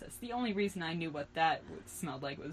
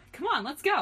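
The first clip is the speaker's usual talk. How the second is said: high, loud and eager; excited shout; 8 semitones higher, 5 dB louder than usual. A narrator's reading. Girl rushing friends out of the car, excited shout. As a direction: urge them along excitedly. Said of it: "I shouted excitedly".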